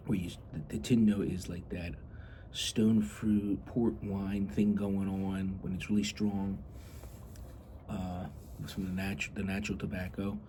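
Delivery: ordinary delivery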